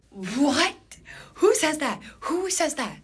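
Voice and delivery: very high, loud incredulous questions; shriek-pitched outraged disbelief; appalled disbelief. Retold or imitated. Woman appalled by what her date said, incredulous. Appalled by date's remark while peeing.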